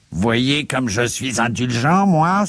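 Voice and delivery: sarcastic indignant clerk shouting; outraged cry of Dindonneau. Acted. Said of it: "s'écria Dindonneau".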